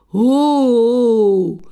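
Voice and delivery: one long, loud ghostly howl; haunt them with a drawn-out hoo; playful ghost wail; spooky hooting. A narrator's reading. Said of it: "strašil Milan".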